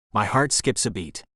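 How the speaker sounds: ordinary delivery, nothing marked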